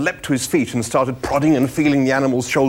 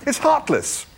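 high-pitched moral outrage; appalled Arthur. A narrator's reading. Arthur condemning eating the inviting animal, appalled. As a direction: plead it high and scandalised. Arthur protesting in acted dialogue.

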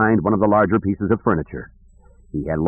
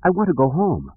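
put-on high-pitched little boy voice; homesick child; 8 semitones higher than usual. Jason shakes his head at Dakim, homesick child. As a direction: plead in a child's higher voice.

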